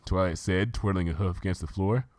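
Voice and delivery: ordinary delivery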